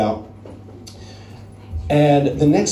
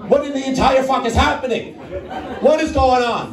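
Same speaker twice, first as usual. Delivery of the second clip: high-pitched, incredulous outburst; exasperated disbelief; 7 semitones higher than usual. Retold or imitated. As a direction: punch out each word in comic bewilderment. Storyteller's incredulous reaction to the chaos.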